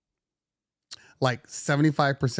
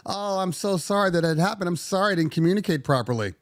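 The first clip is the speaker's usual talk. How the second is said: higher, overly apologetic; self-deprecating apology; 7 semitones higher than usual. Retold or imitated. Owner replying to client emails, self-deprecating. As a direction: grovel sweetly. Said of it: "self-deprecating, making it my fault".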